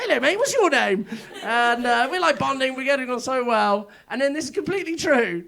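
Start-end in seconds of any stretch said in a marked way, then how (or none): 0.0-1.1 high, sweet voice for a dog; chatting up a friendly dog at the bus stop, sweetly; dog-greeting voice. Retold or imitated. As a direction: coo at the dog like a baby. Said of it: "my special voice that you use for random dogs".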